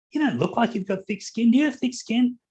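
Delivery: high, puzzled monkey asking a question; puzzled little monkey. Acted. Storyteller voices the monkey questioning the squirrel, high and puzzled. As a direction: lift the pitch, wide-eyed and curious.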